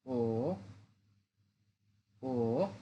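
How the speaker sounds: ও dipping low; Mandarin third tone. Shown as a demonstration. Teacher drills the third tone on ও, low dip. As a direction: drop ও low and curl up. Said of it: "third tone".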